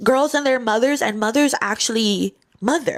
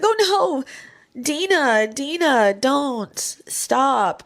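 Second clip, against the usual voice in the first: mock pleading; 5 semitones higher, much slower than usual. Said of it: "I was like".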